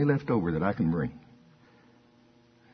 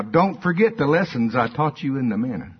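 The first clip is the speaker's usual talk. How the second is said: ordinary talk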